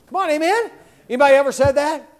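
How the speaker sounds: normal talking voice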